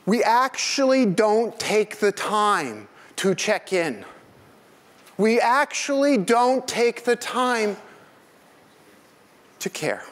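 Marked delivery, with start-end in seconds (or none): 0.1-4.0 high, slow, stressed actually; preacher-like emphasis on the point; emphatic. Shown as a demonstration. 5.2-7.8 high, slow, stressed actually; preacher-like emphasis on the point; emphatic. Shown as a demonstration.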